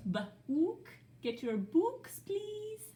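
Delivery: playfully silly ba-ook with a high please. Pretend. Teacher being silly with the class, playful. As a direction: ham it up for the kids. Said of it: "No, that's being silly".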